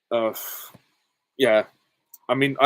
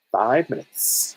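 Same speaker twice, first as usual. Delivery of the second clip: slow drawn-out; 3 semitones higher, much slower than usual. Shown as a demonstration. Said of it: "drawn-out repeat".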